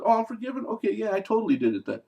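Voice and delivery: hammy fake confession; mock relief.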